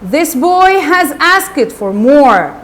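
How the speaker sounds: drawn-out indignant shout, mildly acted; acted outraged cry. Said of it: "the master cried".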